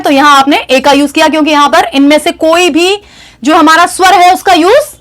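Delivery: ordinary talk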